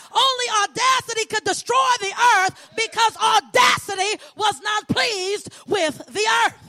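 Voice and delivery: fervent preacher's cadence, rising and falling; preaching. Acted. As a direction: preach it to the congregation with conviction.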